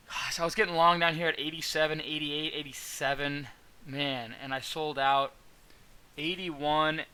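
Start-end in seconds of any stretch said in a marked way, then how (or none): none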